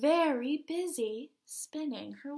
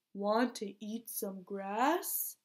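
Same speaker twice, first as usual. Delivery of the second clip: slow, lower cow voice; 4 semitones lower than usual. Acted. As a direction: drawl it like a lazy cow. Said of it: "said the cow".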